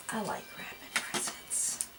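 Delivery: breathy whispered repeat. Shown as a demonstration. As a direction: whisper it to yourself.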